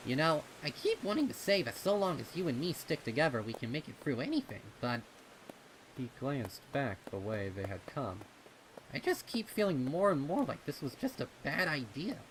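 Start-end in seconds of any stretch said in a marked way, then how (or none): 0.1-5.0 put-on quick, higher young colt voice spilling words out; young pony tumbling out his fears fast; Snips, rushing worries. 8.9-12.2 put-on quick, higher young colt voice spilling words out; young pony tumbling out his fears fast; Snips, rushing worries.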